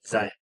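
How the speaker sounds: Zion stressed on ZI, short weak ending; local pronunciation of Zion. Shown as a demonstration. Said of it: "this is how we say it".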